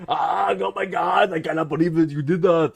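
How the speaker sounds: drawn-out high exclamation in a mock Austrian accent; Arnold Schwarzenegger. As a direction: overdo a strained action-star outburst. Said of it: "be Arnold Schwarzenegger all the time".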